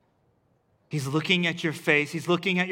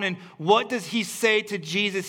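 ordinary talk

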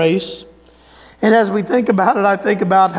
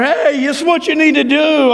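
retold sideline yell; 6 semitones higher than usual. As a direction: imitate yelling advice at a runner. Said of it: "I would be yelling at her".

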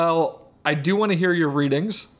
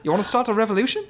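quick, higher-pitched Kiwi impression; Korg; 5 semitones higher, much faster than usual. Podcaster quoting the character to co-hosts, fast Kiwi voice. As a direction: rattle it off in a put-on New Zealand accent. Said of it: "It sounds like Korg".

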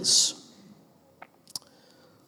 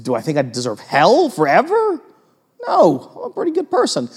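mock self-justifying; 7 semitones higher than usual. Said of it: "people go".

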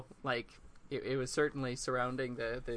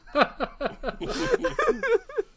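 high burst of laughter; 6 dB louder than usual. Real, not acted. Reader cracks up after reading.

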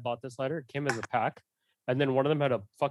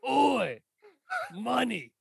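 high mock-cockney grunt; reduced to one gruff word; half-hearted London accent; 11 semitones higher, much slower than usual. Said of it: "your oi voice".

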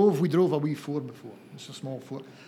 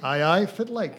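broad Doric greeting, a bit higher and louder; 5 semitones higher, 6 dB louder than usual. Shown as a demonstration. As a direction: say it like a local from Banffshire. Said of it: "Hello, how you doing?".

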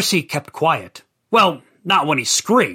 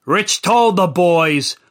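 ordinary delivery

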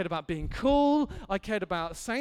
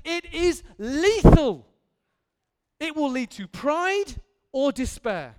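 high, louder alarm on lethal; loud warning; 5 semitones higher, 8 dB louder, slower than usual. Real, not acted. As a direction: sound the alarm.

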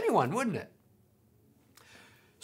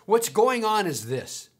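usual speaking voice

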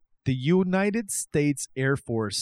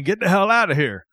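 higher, louder put-on guard voice; mock guard's shout; 6 semitones higher, 7 dB louder than usual. Host plays armed guards to Area 51 raiders, comic shout. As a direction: yell like a guard shooing off intruders. Acting guards pointing guns at stormers.

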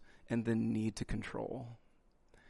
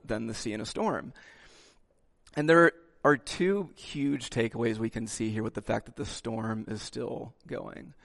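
normal talking voice

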